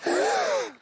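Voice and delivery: sharp excited gasp; surprised gasp. Acted. Surprised at other boat.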